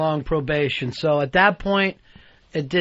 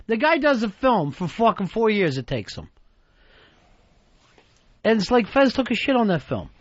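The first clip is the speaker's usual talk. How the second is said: ordinary talk, nothing marked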